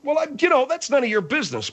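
high, agitated brush-off; angry. Acted. Host told to calm down, angry and defensive. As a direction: sputter it defensively, pitched right up. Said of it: "All right, [name], don't get angry".

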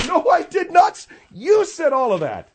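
high, loud yell of protest; indignant, squawking outburst; outraged denial. Acted. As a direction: shout it down, voice shooting way up.

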